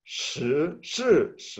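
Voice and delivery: "Shi, shi, shi" rising, falling, rising; Mandarin tones demo. Shown as a demonstration. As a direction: contrast the tones clearly. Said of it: "para decir diez es diez".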